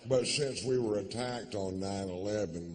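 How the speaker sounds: normal talking voice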